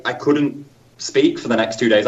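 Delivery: ordinary delivery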